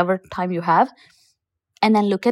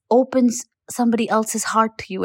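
ordinary talk

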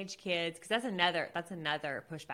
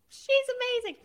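high, loud gushing praise; host retelling how she defended the guest, gushing high and loud; 9 dB louder, faster than usual. Retold or imitated. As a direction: rave about her like a fan. Quoting her defense of guest.

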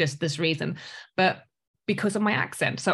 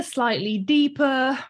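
normal talking voice

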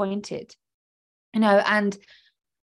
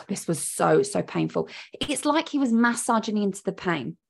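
ordinary delivery

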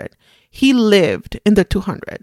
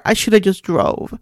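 normal talking voice

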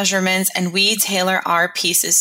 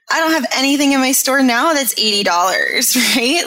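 amused; 5 semitones higher, 3 dB louder than usual. Real, not acted. Amused comparison of prices.

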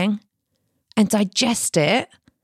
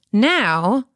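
ordinary talk, nothing marked

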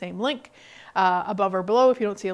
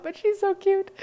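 high cutesy pet voice, much higher; cooing at the cat; 10 semitones higher than usual. Real, not acted. To the kitty she's pet-sitting, a high cooing pet voice. Talking about the cat nearby.

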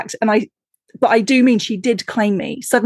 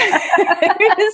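real bright laugh up high; amused laughter; higher laugh; 10 semitones higher than usual. Real, not acted. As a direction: let the laugh ring out. Laughing at her own joke.

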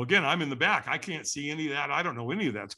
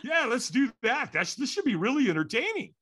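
sarcastic sure; 7 semitones higher than usual. Retold or imitated. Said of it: "And I thought".

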